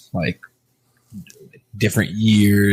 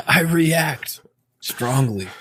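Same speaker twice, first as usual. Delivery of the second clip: mock dramatic echo; 8 semitones higher, much slower than usual. Laughing mock repeat of friend.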